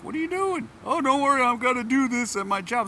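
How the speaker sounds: high, bright-eyed junior voice; eager young junior. Retold or imitated. Younger coworker reassuring boss, high eager voice. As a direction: play the keen junior.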